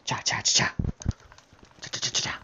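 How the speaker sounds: whispered cha cha digging sounds; mouth digging noises. Pretend.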